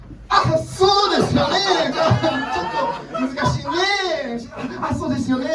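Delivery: high, flustered stalling, sliding toward a whimper; a put-upon teacher stammering in high Japanese. Retold or imitated. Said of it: "the vowels keep getting deeper".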